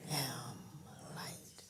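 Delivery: breathy whispered "am light", barely audible; hushed whispered "am light"; whispered affirmation. Real, not acted. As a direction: whisper the words "am light" like a private prayer.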